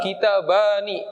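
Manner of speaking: kitabani said slowly with a clear -ani ending; slow model word. Shown as a demonstration. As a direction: spell out kitabani slowly for the class.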